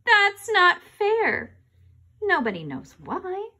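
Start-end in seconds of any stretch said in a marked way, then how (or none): 0.1-1.6 put-on high, slow, drawn-out childish whine. Kid whining to grown-up at end of why game. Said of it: "then you have to whine".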